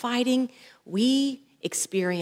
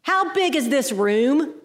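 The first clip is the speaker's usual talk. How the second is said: higher, drawn-out heckle; retold yell; 5 semitones higher, 3 dB louder than usual. Student interrupting the yoga teacher, mock yell. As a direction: call out slowly like an annoyed student. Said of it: "This student beside me yells out".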